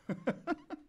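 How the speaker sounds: high, soft burst of giggles; real laugh. Real, not acted. Host laughing at own over-excited product announcement. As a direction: crack up after getting carried away. Cracks up after hyped announcement.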